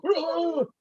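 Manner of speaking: sudden high yuck of disgust; disgusted gag. Retold or imitated. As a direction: retch it out like tasting something foul. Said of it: "I go".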